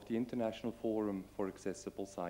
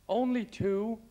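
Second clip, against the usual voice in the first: high, loud disbelieving question; surprised; 13 semitones higher, 9 dB louder, slower than usual. Real, not acted. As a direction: sound amazed so few answered. Disappointed at few raised hands.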